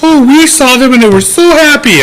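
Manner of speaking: high, loud, sweet mock innocence; mocking old couple voice. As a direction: parody a too-sweet old lady. Imitating the old couple's testimony.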